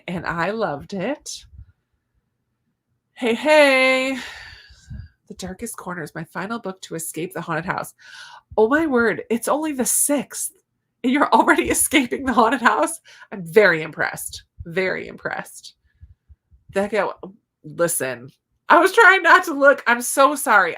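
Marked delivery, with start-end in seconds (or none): 3.1-4.2 cheerful hey. Real, not acted. Cheerful greeting to chat. 8.6-13.0 amazed delight. Real, not acted. Amazed viewer finished early. 18.7-20.7 flustered outburst. Real, not acted. Ad played, apologizing.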